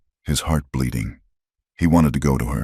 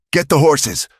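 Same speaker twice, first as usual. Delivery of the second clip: yelled; 11 semitones higher than usual. A narrator's reading. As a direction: yell over the flames. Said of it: "Silas yelled".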